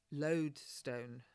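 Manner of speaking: ordinary delivery, nothing marked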